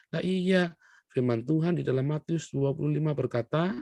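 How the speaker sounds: ordinary talk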